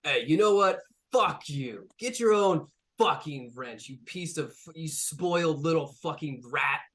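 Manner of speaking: loud, high snapping insult; high furious robot cussing out a spoiled boss; angry outburst. Acted. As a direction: lose it and tell him off. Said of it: "this Rai Rai goes".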